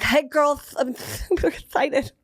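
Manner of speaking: chirpy quote with a lisp; lisping stripper. Retold or imitated. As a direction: gush it with a lisp, laughing. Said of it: "had a major lisp".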